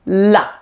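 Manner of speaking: LA with tongue touching upper front teeth; dark L demo. Shown as a demonstration. Teacher showing tongue position, isolated L sound in LA. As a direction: press the tongue up for the L in LA. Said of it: "like this".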